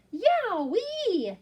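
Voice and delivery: high delighted yowee; excited yowee. Acted. Young yak tasting the foods, high excited yowee. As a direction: burst out with joy. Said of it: "said the young yak".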